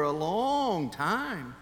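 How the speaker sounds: very slow, stretched-out words; drawn-out emphasis. Retold or imitated. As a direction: drag it out like an endless wait. Drags out the long wait.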